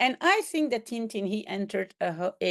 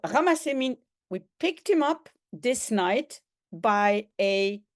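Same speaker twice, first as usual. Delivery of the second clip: ordinary talk, nothing marked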